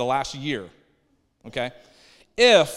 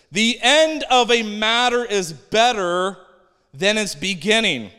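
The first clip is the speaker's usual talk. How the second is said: raised, louder, slow proclamation; scripture reading; 5 semitones higher, 6 dB louder than usual. Real, not acted. Preacher reading Ecclesiastes to the congregation, slow proclaiming voice. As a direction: read the verse out slowly and emphatically.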